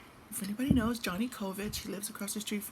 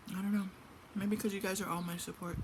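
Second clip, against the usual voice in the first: ordinary talk, nothing marked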